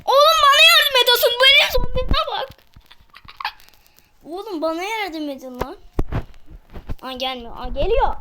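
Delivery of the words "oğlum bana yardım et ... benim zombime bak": high, loud call for help; shouting for help. Real, not acted. Yelling to teammates while a zombie attacks, loud and high. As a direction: yell to teammates for backup.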